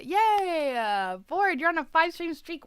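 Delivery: high, loud congratulations; excited cheer. Real, not acted. Streamer celebrates a viewer's streak, excited and loud.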